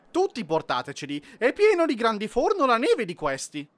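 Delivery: high, loud, odd royal penguin voice; commanding penguin. Acted. Penguin ruler commands his subjects, loud high voice. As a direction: order the crowd about in a strange voice.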